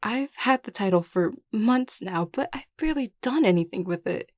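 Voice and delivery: overwhelmed. Acted. New crown confessing inexperience to a friend, overwhelmed. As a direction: let the worry spill out, pitch climbing.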